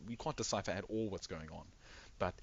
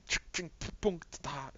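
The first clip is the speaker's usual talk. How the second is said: punchy, higher mechanical sound effects; imitated tinkering noises; 7 semitones higher than usual. Game master miming a repair, imitated clinking noises. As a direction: make clinks and thunks with the mouth.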